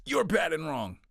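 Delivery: accusing judgment. Retold or imitated. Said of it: "a judgment that says".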